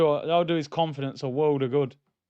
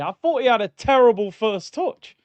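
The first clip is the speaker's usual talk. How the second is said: ordinary talk, nothing marked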